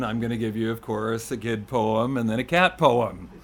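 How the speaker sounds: usual speaking voice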